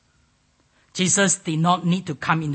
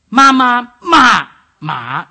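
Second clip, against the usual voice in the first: high level "Ma, ma", falling "ma", dipping last "ma"; very loud, exaggerated tone demonstration; Mandarin tones; 6 semitones higher, 12 dB louder, slower than usual. Shown as a demonstration. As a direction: hold the first two high, plunge the third, dip the last. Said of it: "if you put into these tones".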